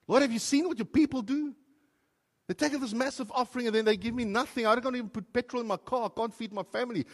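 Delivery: an indignant cry of unfairness; angry complaint to God. Retold or imitated. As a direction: rail at God like you've been cheated.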